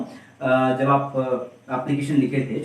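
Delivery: ordinary talk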